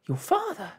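high, loud flustered cry; startled exclamation. A narrator's reading. Mother realizes father is home, flustered exclamation. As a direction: burst out in alarm. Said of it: "exclaimed Homily".

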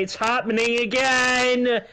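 slow, high, loud frustrated wail; exasperated shout. Real, not acted. Player seeing it happening again, exasperated shout. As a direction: drag it out loudly in despair.

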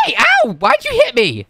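high-pitched indignant yelp; shocked, squeaky complaint; startled protest. Acted. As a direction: yelp as if suddenly hit. Hit by friend, protests.

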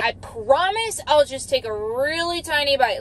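ordinary delivery

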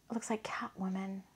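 breathy hushed aside; whisper. Real, not acted. Reader whispering to viewers about the card's art, hushed. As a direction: whisper it like a secret.

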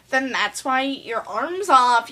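ordinary talk

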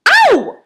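very high, loud yelp of pain; yelled yelp. A narrator's reading. Girl jerking her foot out, high pained yelp. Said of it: "the girl yelled".

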